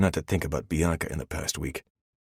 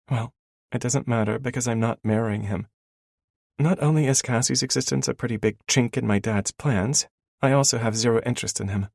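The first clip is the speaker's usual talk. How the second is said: put-on higher, brisk young woman; female character voice; 8 semitones higher, 4 dB louder than usual. A narrator's reading. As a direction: speak as the heroine, lightly. Elena's dialogue.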